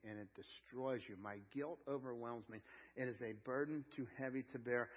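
soft, low, solemn; hushed. Real, not acted. Preacher quietly telling the congregation about guilt, hushed. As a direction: drop the voice and let it weigh.

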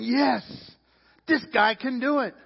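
loud, high rallying cry; fired-up shout of approval; excited supporter. Acted. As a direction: cheer on your chosen champion. Voicing the excited supporters of Barabbas.

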